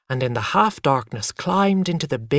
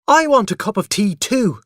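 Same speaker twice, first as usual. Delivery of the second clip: put-on loud, higher demanding child; shouted child's demand; shouting; 9 semitones higher, 6 dB louder than usual. A narrator's reading. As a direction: demand it at full voice. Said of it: "I shouted".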